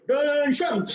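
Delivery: loud sharp "Attention!" like a drill master; barked command. Retold or imitated. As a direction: shout the order at the assembly. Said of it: "But then he said".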